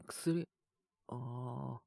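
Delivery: ordinary delivery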